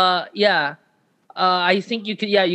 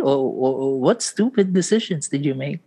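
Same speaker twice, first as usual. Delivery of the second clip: normal talking voice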